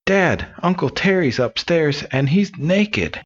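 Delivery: high, slow kiddie voice; children telling dad. Acted. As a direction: pitch it up like kids tattling to dad. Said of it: "his son and daughter tell him".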